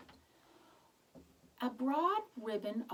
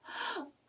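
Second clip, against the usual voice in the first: sharp delighted gasp; high delighted gasp; surprised gasp; 9 semitones higher, 3 dB quieter than usual. Acted. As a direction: gasp in wonder. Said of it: "The old couple smiled".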